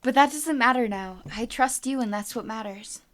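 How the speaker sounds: soft, low line; quietly. Acted. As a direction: say it quietly and gently. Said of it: "He said quietly".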